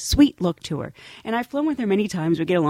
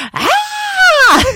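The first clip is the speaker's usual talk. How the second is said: high shrieking scream, laughing; new girl screams at the mouse, high and loud; startled scream; 9 dB louder than usual. Retold or imitated. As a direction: shriek as a toy mouse runs at you. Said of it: "literally arms in the air, was like".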